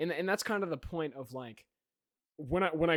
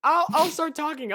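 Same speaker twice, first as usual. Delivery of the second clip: high, loud, laughing; 12 semitones higher, 9 dB louder than usual. Real, not acted. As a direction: push through the giggles. Laughing takeover line, loud high.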